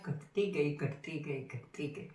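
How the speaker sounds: low, fading further with each repeat; dwindling away. Acted. Repeats to show numbers dwindling away.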